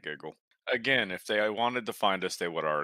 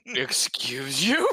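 mock offended; 4 semitones higher, 4 dB louder, slower than usual. Mock-offended reply to flirting.

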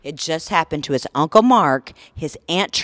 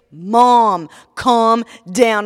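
loud, higher, imitated son pleading for calm; exasperated son; 6 semitones higher, 10 dB louder, much slower than usual. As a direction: play the son trying to settle Mom. Voicing son calming hysterical mother.

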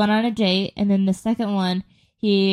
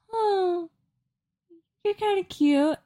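put-on high, dreamy naive teen voice; naive younger self; 10 semitones higher, slower than usual. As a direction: play her starry-eyed eighteen-year-old self. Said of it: "naive eighteen-year-old me was like".

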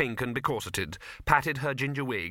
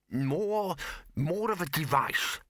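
hesitant, higher-pitched correction; uncertain; 4 semitones higher, slower than usual. A narrator's reading. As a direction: feel your way to the word, unsure. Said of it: "said the bursar uncertainly".

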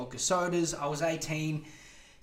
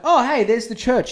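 mock cheerful; 8 semitones higher, 11 dB louder than usual. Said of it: "I'm like".

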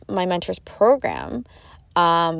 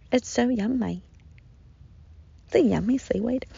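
whisper; 4 semitones higher, 5 dB quieter than usual. Real, not acted. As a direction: breathe it softly to the little one. Baby eating seaweed.

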